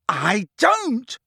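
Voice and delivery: high, drawn-out denial; screaming. A narrator's reading. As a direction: scream it from the throat. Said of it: "Harry screamed, so loudly".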